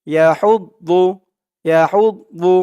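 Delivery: "ইয়া হৌদ দু" with heavy, full-mouthed "দু"; full-mouthed daad. Shown as a demonstration. Teacher drilling the daad sound, heavy "দু". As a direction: fill the mouth on the d of "দু". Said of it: "মুখটা একটু ভরপুর হয়ে যাবে".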